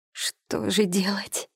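slow tearful whisper; sobbing whisper. A narrator's reading. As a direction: whisper it through sobs. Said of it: "всхлипывая, прошептала она".

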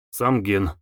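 usual speaking voice

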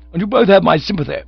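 high, loud, quick jibe; indignant defiance. A narrator's reading. Cyril insulting manager and son, high quick defiance. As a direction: fling back the insult.

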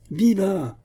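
high-pitched cheer; exultant exclamation; joyful cry. A narrator's reading. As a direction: cry out in triumph. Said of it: "s'écria Porthos".

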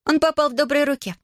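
high, lightly mocking lilt; cheeky and pleased with herself; smirking. A narrator's reading. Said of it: "усмехнулась Нелла".

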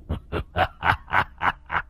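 soft low ghostly chuckle; a spirit's breathy laugh; eerie laugh. A narrator's reading. The demon laughs softly after its threat.

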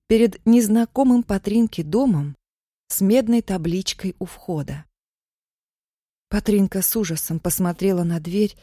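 ordinary delivery

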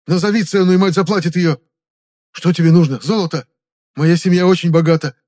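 loud, high, agitated appeal; mounting panicky insistence; desperate bargaining. Acted. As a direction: pull rank, then beg with offers. Captive boasts and offers ransom desperately.

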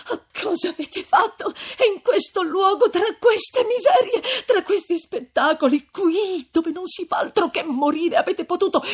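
high, loud tearful wail; distraught female character, voice soaring; anguished reproach. A narrator's reading. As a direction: reproach him through tears. Lucia reproaches Renzo in anguish.

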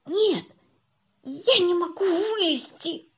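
высокий, протяжный жалобный голос; пожалуйся, что застрял, растягивая слова; жалобно. A narrator's reading. Застрявший мальчик жалобно отвечает из ящика. (жалобно тянуть)

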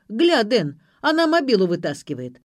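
loud alarmed shout; acted rough yell of alarm. A narrator's reading. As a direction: shout a warning to your mate. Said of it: "заорал Юрик".

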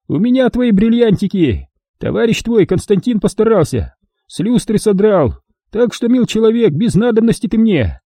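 put-on high, cheerful cruel old man. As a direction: play a folksy sadistic old prospector. Old man taunts victim.

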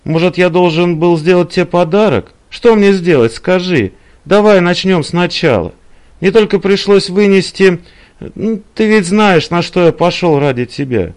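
high, loud, agitated pleading; exasperated man begging to start over; pleading Claude. Acted. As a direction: plead with an angry lover.

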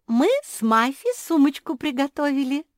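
high, loud singsong voice; singsong announcement. A narrator's reading. Жозефина presents the handbag to Куки, singing it out. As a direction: sing it out proudly as a gift-giver. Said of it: "пропела Жозефина".

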